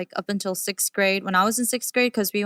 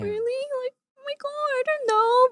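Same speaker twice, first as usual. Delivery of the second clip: high, squeaky disbelief; mock amazed gasp of a reply; retelling her own high-pitched surprise; surprised. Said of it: "And I was like".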